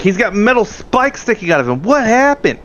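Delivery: high, alarmed exclamation; acted shock. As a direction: climb to an incredulous high-pitched question. Character reacts to spiked injured man.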